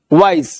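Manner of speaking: वाइज as one single, falling syllable. Shown as a demonstration. Teacher showing a one-syllable word, वाइज in one go. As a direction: say वाइज in one unbroken beat. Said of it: "एक बार में, दस बार में".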